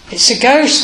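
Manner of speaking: lightly voiced fearful exclamation; startled disciples, lightly acted; frightened exclamation. A narrator's reading. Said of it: "cried out in fear".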